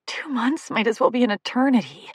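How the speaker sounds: ordinary delivery, nothing marked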